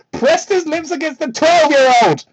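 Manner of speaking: ordinary talk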